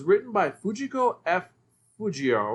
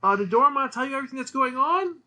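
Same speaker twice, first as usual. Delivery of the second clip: high, quick cartoon boy; a high, hurried young boy; descendant kid voice; 8 semitones higher, 3 dB louder than usual. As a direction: rattle it off like an eager little boy from the future. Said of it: "He says".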